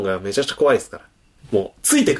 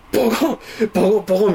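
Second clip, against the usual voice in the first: imitated banging; 9 semitones higher, faster than usual. Imitating repeated banging from upstairs.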